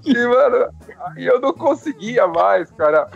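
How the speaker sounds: laughing. Real, not acted. Still laughing, raised voice.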